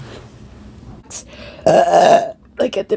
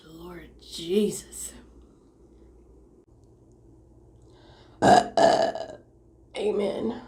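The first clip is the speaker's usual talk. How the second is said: slow breathy whisper; 7 dB quieter, slower than usual. Real, not acted. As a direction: whisper it slowly. Relieved after the burp.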